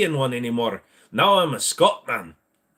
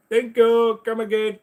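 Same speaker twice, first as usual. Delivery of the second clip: put-on higher, louder Indian shopkeeper accent; hammed-up Indian cashier thanking a customer; mock shopkeeper; 10 semitones higher, 5 dB louder than usual. Streamer joking as a shop clerk, high mock accent.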